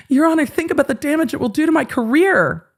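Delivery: high whiny begging; whiny plea. Pretend. Mocking Ron begging the judge about his career, whiny. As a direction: plead like a kid.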